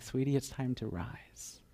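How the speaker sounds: slow tender whisper. Acted. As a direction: breathe it close to her ear. Jesus waking the girl.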